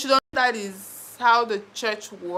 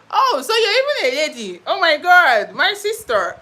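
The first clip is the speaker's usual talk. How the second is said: surprised delight; 9 semitones higher, 10 dB louder than usual. Real, not acted. Viewer Kira reveals she's a lady.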